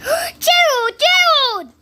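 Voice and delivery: high, eager call with a gasp; gasp, then call out to your friend; excited calling out. Acted. Piggie calls out to Gerald.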